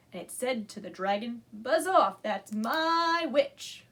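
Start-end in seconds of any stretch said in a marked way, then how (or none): 1.5-3.9 loud, slow monster threat; a hint of a scary beast; terrible beast voice. A narrator's reading. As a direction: menace the dragon slowly. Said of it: "a yowl and a growl and a croak".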